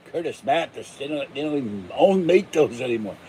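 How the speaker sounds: normal talking voice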